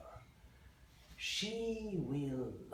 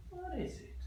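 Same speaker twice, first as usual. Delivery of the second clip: much higher, startled question; surprised; 3 dB louder than usual. Acted. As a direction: gasp it out in surprise. Pilot spots something on tower.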